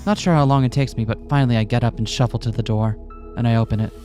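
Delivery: ordinary delivery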